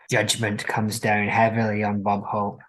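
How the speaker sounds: ordinary talk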